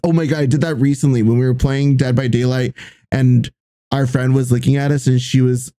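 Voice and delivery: usual speaking voice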